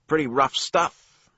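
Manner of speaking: high, loud, slow clerk voice. A narrator's reading. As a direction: comment with dry interest. Thumbface comments on book.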